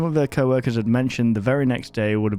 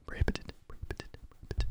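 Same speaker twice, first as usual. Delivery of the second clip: breathy echoing whisper, each softer; spooky whisper; 16 dB quieter than usual. Pretend. Gamer echoing the tape's warning in a creepy whisper. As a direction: whisper it three times, fading away.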